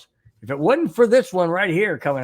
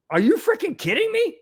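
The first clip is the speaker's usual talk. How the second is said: outraged; 13 semitones higher than usual. Real, not acted. Outrage at the cover-up.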